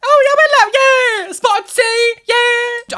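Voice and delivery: very high, squeaky animal voice; silly cartoon deer; voicing a friendly deer. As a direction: pretend the deer is chirpy and welcoming. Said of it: "they're not gonna be like".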